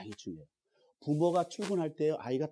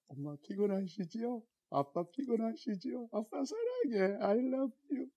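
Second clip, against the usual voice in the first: put-on sweet child; 8 semitones higher, 3 dB quieter, slower than usual. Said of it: "그리고 웃으면서".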